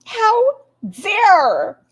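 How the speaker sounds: high, loud, hammed-up gasp of offence; mock-scandalized cry about the rug; mock outrage. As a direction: play it scandalized over nothing. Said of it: "how dare she disrespect the rug".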